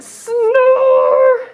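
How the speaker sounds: high, slow, drawn-out wonder; storybook awe. Acted. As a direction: marvel at it for the kids. Showing the giant snore.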